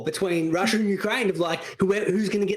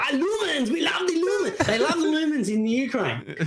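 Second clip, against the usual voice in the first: put-on laughing accent bit; 7 semitones higher than usual.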